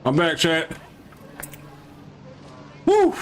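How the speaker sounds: ordinary talk, nothing marked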